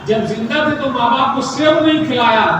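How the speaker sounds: ordinary delivery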